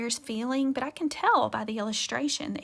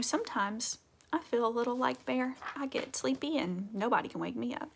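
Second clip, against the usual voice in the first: ordinary delivery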